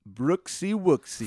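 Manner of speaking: high, slow, drawn-out taunt; genie. Acted. Voice heard before you die, eerie drawn-out call. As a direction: sing out the pet name slowly. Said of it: "a voice just goes".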